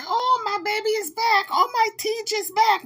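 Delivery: high, mock gushing; swooning Jaguar. Imitating Jaguar greeting TJ, mock gushing. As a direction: coo over the one who came back. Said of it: "before Jaguar would say".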